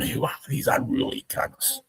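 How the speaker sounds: hint of appalled British onlookers, high and hushed; mock British outrage. Storyteller voicing the British watching rowdy Australians, mock outrage. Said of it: "the British are going".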